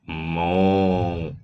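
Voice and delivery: low, drawn-out mock moo like an ox. Shown as a demonstration. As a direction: moo like a cow for the kids. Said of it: "O que é som grave?".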